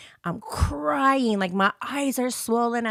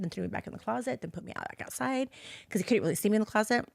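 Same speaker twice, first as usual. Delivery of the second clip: plain reading voice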